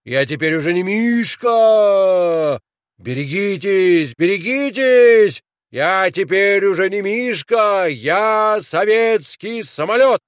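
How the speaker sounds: high, ringing, excited call; high boyish shout. A narrator's reading. Mishka warns everyone he is a plane, shouting high. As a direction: cry out high like a plane overhead. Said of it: "и кричал он".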